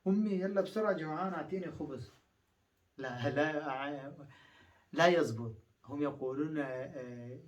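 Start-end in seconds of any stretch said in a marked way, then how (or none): 0.1-2.1 put-on quick, pleading kid asking mom for bread; hungry child to mom. A hungry child urging his mother for food, fast and pleading. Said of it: "إذا بالعربي قلت".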